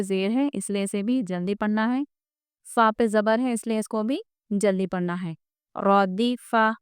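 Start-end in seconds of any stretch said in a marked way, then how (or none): 5.8-6.8 drawn out slowly syllable by syllable; slow model reading. Shown as a demonstration. Teacher modelling the word to pupils, slowly. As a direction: stretch the word out for students. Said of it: "teacher models slow reading".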